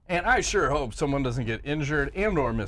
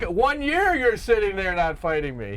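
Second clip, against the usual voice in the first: higher, louder taunting callout, slowly; mock callout yell; 6 semitones higher, 4 dB louder than usual. Fighter taunting a rival who won't fight, mock yell. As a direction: jeer at him like a trash-talking fighter.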